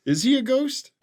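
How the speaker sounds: normal talking voice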